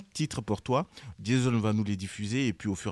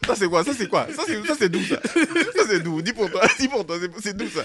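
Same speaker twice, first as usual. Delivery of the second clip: laughing, hammed-up Ivorian accent, higher and louder; 8 semitones higher, 3 dB louder than usual. Host teases studio guests about accents, hammed-up Ivorian accent. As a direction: toss it off in a playful Ivorian accent. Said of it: "l'accent en question, c'est doux".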